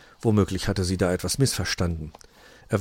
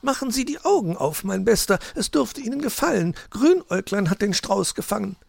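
much higher playful character voice; teasing guest; 13 semitones higher than usual. Acted. Guest telling Schwarzrock to open his eyes, high and teasing. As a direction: coax him to open his eyes, amused.

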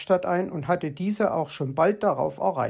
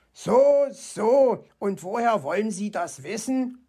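skeptical fox; 4 semitones higher than usual. A narrator's reading.

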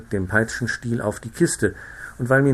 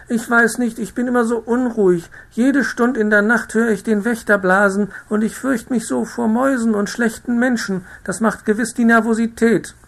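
high, loud plaintive wail; a fussy matron moaning to the doctor; complaining plump madame, high-pitched; whining lady; 12 semitones higher, 5 dB louder than usual. A narrator's reading. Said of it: "fing sie zu klagen an".

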